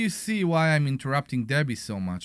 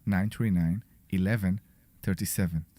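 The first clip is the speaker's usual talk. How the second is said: sexy voice; 6 semitones lower than usual. Pretend. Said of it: "Do the sexy voice".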